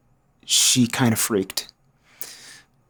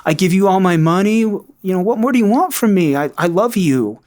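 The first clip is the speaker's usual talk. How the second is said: pleading, high-pitched mock protest; gaslighting denial; 7 semitones higher than usual. Addict husband protesting to his wife about money and love, pleading. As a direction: plead innocence as if wronged. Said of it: "it was gaslighting".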